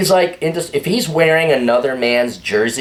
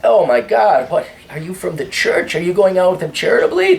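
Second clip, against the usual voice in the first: mock disbelief; 6 semitones higher than usual. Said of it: "Uh, no".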